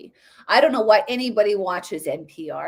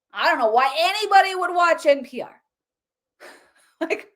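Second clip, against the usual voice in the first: ordinary delivery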